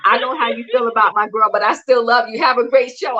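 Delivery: ordinary talk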